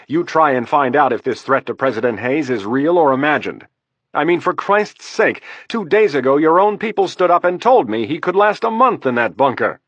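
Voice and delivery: exasperated vice president pulling rank; fast, heated, for Christ's sake rising; shot back. Acted. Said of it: "Baxter shot back".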